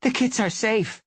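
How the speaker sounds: high, loud, relieved gasp of news; breathless relief. A narrator's reading. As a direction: announce the kits are fine, still panting. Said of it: "she meowed with a gasp".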